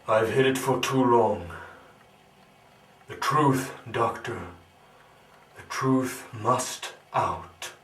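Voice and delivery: loud, impassioned confession; forceful declaration. Acted. Telling the doctor the truth must out, forcefully declaimed. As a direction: proclaim it, slowing to a weighty finish. Resolves to reveal the hidden truth.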